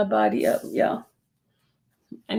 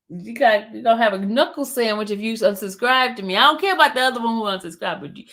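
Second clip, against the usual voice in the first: normal talking voice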